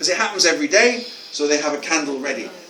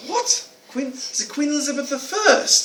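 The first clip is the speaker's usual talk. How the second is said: high-pitched, stumbling astonished query; incredulous echo question; 10 semitones higher than usual. Retold or imitated. Author to boy after unexpected answer, incredulous echo question. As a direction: replay disbelief, pitched right up.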